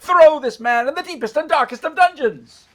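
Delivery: high, loud shouted command; enraged royal order. Acted. King condemning the middle brother, enraged shout. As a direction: thunder out the sentence at full volume.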